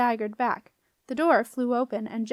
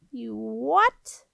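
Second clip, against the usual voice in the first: drawn-out, incredulous question rising at the end; squeaky doorkeeper in disbelief; amazed; 4 dB louder than usual. A narrator's reading. Doorkeeper astonished by the girl's demand, incredulous.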